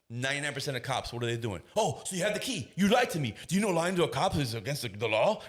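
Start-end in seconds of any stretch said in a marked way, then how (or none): 1.7-5.4 high, loud accusing rant; mock outraged cop. Cop accusing a father of lying, mock outrage. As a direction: pounce on the lie with exaggerated outrage. Typical cop's angry reaction acted.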